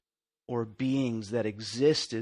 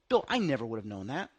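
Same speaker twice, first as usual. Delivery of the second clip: ordinary talk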